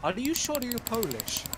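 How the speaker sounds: high, loud mock disbelief; imitating doubtful border guard. Border guard checking his passport, mock incredulous questioning. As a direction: question it with incredulous, rising pitch.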